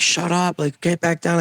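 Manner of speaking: high-pitched retold urgent order; urgent hushing. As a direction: imitate alarmed friends telling her off. Everyone urging her down.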